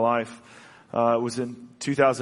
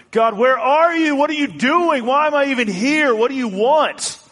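high, loud anguished cry; shouting up at the sky, pleading; yelling at God; 13 semitones higher, 8 dB louder than usual. Retold or imitated. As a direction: cry out to the heavens in frustration. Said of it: "And I would yell".

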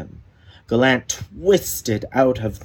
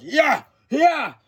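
battle cry. Acted. Fighting the bear.

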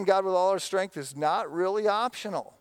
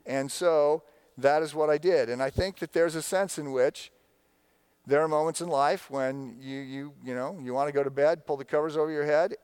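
usual speaking voice

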